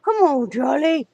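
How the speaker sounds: high excited pet voice. Real, not acted. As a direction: coo at the dog. Talking to the dog Charlie.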